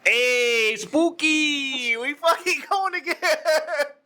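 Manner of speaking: high-pitched, hammed-up Mexican accent; Mexican accent impression. Shown as a demonstration. Friend performing his best Mexican impersonation, hammed-up Mexican accent. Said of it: "Give me your best Mexican impersonation".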